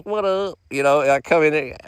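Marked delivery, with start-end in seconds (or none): 0.0-0.6 a light, higher mock greeting; casual mock "what up"; lightly voiced worker; imitating counter worker. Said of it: "they be talking like this".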